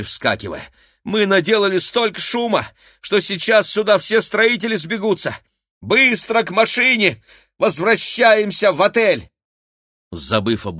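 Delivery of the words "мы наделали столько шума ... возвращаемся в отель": high, loud hurried orders; rally them to flee; urgent shouting. A narrator's reading. Igor urging escape.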